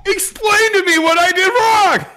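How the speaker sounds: mock indignant protest. Friend defending his swearing in England, mock indignant protest.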